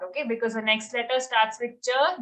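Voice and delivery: ordinary talk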